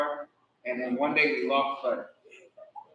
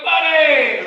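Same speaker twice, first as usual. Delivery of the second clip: high, loud cry of relief; surprised shout; 12 semitones higher, 12 dB louder than usual. Retold or imitated. Finding the dog in the washroom, he shouts its name. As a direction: call the dog's name in surprise. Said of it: "And he was like".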